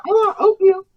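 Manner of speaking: high mocking child whine; mock kid voice. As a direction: sneer like a spoiled kid. Mocking a kid's reaction.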